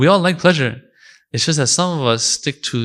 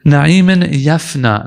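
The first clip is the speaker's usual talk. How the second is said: "na'imin yafna" slow and louder, weight on "na'imin"; stress on na'imin yafna; 6 dB louder, much slower than usual. Shown as a demonstration. Explaining Imam Ali's words, slow and louder on "na'imin yafna". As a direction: lean hard into "na'imin yafna". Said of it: "not na'imin, period".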